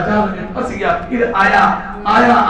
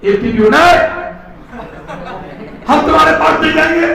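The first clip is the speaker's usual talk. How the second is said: acted balky refusal, pitched up; stubborn refusal; 5 semitones higher than usual. Wary ATP answering glucose, stubborn refusal. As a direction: refuse like someone once bitten.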